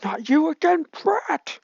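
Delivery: high, loud sneering villain voice; sludge villain snarl. Acted. Sludge villain sneering at Izuku, high and loud. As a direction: sneer it at the kid as the monster. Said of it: "the sludge villain sees Izuku as, as he says".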